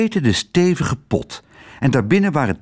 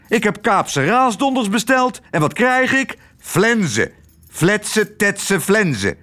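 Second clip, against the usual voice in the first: much higher, indignant ranting; angry rant; 9 semitones higher than usual. A narrator's reading. Retired admiral scolding the waitress, high angry rant. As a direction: spit out the pancake names in disgust. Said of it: "riep hij".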